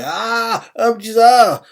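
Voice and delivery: high, loud alarmed cry of ah blizzard; sudden alarmed shout; startled cry. Retold or imitated. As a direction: yelp it out as the storm hits. Ten blown away, crying out.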